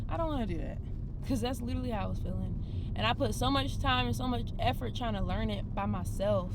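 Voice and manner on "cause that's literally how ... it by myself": usual speaking voice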